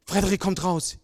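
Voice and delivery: ordinary talk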